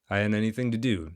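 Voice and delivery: ordinary delivery, nothing marked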